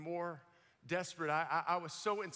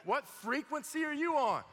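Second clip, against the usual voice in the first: louder, higher, astonished whoa; amazed question; 6 semitones higher, 5 dB louder, slower than usual. Retold or imitated. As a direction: marvel at her intensity. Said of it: "You're like".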